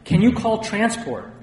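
high, loud urgent request; panicked cry. A narrator's reading. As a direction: call for help in a panic. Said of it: "I cried".

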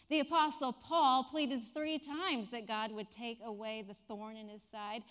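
normal talking voice

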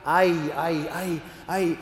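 nervous, halting false starts; paralyzed stammer. Acted. Franciscan facing God's question, paralyzed stammer. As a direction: stammer as if struck dumb. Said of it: "the Franciscan is just paralyzed".

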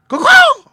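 very high, loud crow call; rascally crow caw. A narrator's reading. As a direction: screech out a crow's caw. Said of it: "said another one".

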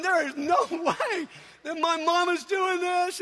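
slow, high-pitched protest; retold total disbelief. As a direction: exclaim like a stunned, mortified child. Retold child's disbelief at mom.

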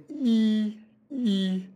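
drawn-out "E" with the tongue high and forward; long front E. Shown as a demonstration. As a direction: hold "E" as a long ee sound. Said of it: "position of the tongue in the mouth is relatively forward".

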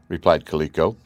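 usual speaking voice

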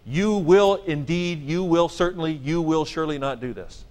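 mock-solemn warning to evil demons; emphatic denial. Shown as a demonstration. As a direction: stress each "will" as if scolding demons. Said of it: "and you say to them".

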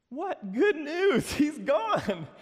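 high, loud, drawn-out disbelief; acted incredulity. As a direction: protest like a bewildered follower left behind. Voicing a confused, abandoned Israelite.

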